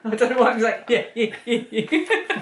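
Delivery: talking through giggles; laughing. Real, not acted. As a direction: crack up at yourself mid-sentence. Cracking up at own Cockney attempt.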